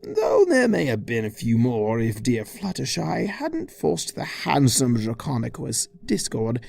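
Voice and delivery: ordinary delivery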